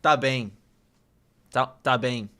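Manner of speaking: ordinary talk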